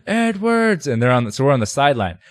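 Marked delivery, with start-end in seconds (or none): 0.1-0.9 high mocking call of a name; teasing shout. Teammate mocks the player's new fan club. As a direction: echo the hecklers to tease him. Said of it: "fan club here".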